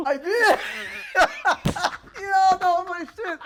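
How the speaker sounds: bursting into laughter mid-sentence; laughing. Real, not acted. Excited laughter about favourite show.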